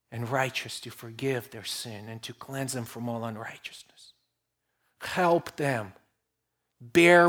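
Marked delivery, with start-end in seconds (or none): 0.1-4.1 whispered, much softer, barely voiced; hushed whisper. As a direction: let the promise fall to a whisper. Preacher quoting scripture, voice drops.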